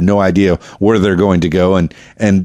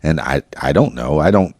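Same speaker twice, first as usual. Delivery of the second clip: ordinary talk, nothing marked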